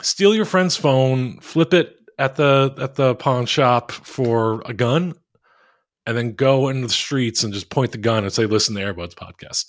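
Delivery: ordinary delivery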